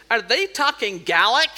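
loud, slow baffled heckle; imitating an audience member's loud, drawn-out question. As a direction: call it out loudly from the front row. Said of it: "said very loudly".

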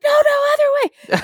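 very high, alarmed mock yell; imitated shouting. Sailor sees the boat careening at the dock, mock alarmed yell. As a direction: imitate a panicked correction yelled across a boat. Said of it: "he's shouting all these instructions".

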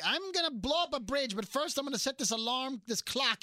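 high, hammed-up Batman villain scheming; old TV villain. Villain explaining his trap to Batman, campy. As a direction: announce the evil plan in a campy high voice. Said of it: "All the villains are like".

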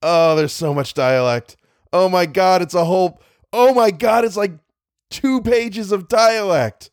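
dismayed whining. Real, not acted. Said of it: "I don't wanna do it".

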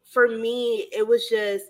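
normal talking voice

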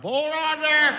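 high, loud drive-thru speaker call; drive-thru worker. Acted. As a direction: bark it through the speaker box.

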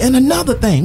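high-pitched mock yell; imitated yelling dad. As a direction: butt in like a hollering dad. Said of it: "he yells out at us".

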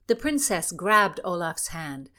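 plain reading voice

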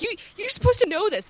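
high, disbelieving mock scold; incredulous retort. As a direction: tell him he ought to know this. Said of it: "So I was like".

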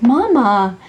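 imitating a small boy; little son imitated. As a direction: voice a sweet toddler comforting mom. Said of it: "he was like".

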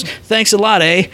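high, chirpy mock Canadian thanks; imitated Canadian diner. Canadian diner thanking the departing waitress, imitated accent. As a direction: imitate a cheerful Canadian with a lilting "eh". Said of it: "he goes".

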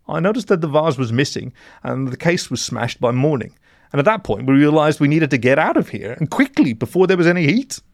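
high, urgent character voice; anxious mole in blue. A narrator's reading. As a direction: explain nervously, pitch climbing as you go.

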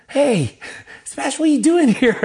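high, loud call of surprise; surprised greeting. Retold or imitated. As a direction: act out a student spotting you unexpectedly. Student calling out to him in surprise.

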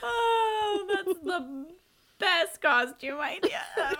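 laughing. Real, not acted.

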